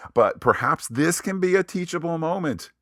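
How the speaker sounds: high-pitched mock earnestness; mock-hopeful, tongue in cheek; slightly sarcastic. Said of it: "I'm being slightly sarcastic here".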